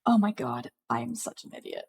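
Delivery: real self-reproach, trailing into a whisper; dismayed muttering. Real, not acted. Game master realises the music was not uploaded, dismayed muttering. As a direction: mutter it to yourself, almost voiceless.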